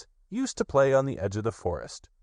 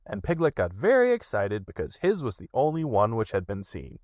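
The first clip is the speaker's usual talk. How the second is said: ordinary delivery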